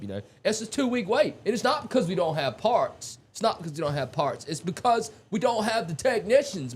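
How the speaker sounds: high-pitched put-on Jim Farley accent. Ranting about unfilled technician jobs in a high Jim Farley accent. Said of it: "that was a Jim, that was a Jim, Jim Farley accent".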